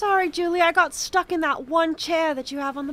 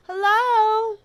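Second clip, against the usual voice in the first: calling out; 5 semitones higher, 9 dB louder than usual. Acted. Calling into ruined town.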